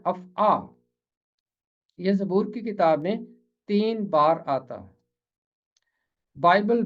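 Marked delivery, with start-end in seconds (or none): 0.1-0.5 अफ़आ with a long open "aa" at the end; two-syllable अफ़आ, no "ee" ending; original pronunciation. Shown as a demonstration. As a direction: model अफ़आ as af-aa. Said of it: "असल इसका तलफ़ुज़ है".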